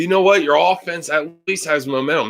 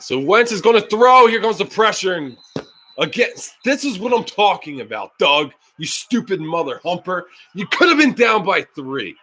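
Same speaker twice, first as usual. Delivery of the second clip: ordinary talk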